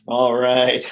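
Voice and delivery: ordinary talk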